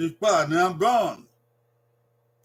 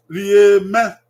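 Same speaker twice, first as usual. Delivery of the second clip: Rieme called out loud with a lift at the end; loud drill; 4 semitones higher, 9 dB louder than usual. Shown as a demonstration. Teacher calling Rieme out loud for children to repeat. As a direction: call the word out clearly to be copied. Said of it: "teacher models Edo word loudly".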